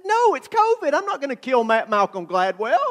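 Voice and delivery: high, exasperated protest; mock indignation. Defending himself for skipping the handshake, mock-indignant. Acted indignant answer to Cindy.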